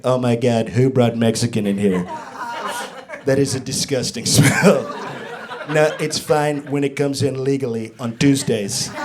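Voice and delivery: low, disgusted Trump voice; low mock Trump in the office; Trump impression. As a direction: sneer at the smell like Trump. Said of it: "what if Trump".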